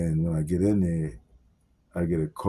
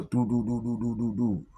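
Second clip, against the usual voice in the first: imitating door banging; 4 semitones higher, 3 dB quieter than usual. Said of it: "we get a bang on the side door".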